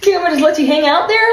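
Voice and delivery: high, fast, laughing retort; incredulous rant. Retold or imitated. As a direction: rattle it off in disbelief. Retold comeback, high and fast.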